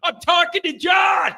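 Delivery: retold coworker shout. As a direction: bark at Gary to shut up. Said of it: "He's like".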